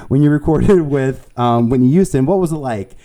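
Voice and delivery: another stab at a mock Staten Island accent; bad Staten Island accent. Asking about a recording, in a badly faked Staten Island accent. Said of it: "my accent's so bad".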